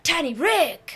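loud excited call; excited shout. Retold or imitated. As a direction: shout it out happily. Calling out car name.

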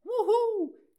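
very high, loud falling wuhuu cheer; whoop. Acted. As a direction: cheer like a fan at a concert. Cheer after each patron thanked.

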